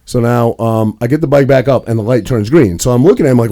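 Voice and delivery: ordinary talk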